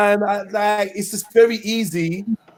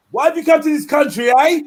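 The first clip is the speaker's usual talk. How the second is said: higher, louder mock shout of the angry preacher; imitating the preacher; 7 semitones higher, 4 dB louder than usual. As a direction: ham up the ranting preacher's interrogation. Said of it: "Why are you talking like that?".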